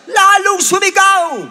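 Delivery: high, loud, stretched shout. Acted. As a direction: shout it out with rising fervour. Preacher acts out crying louder.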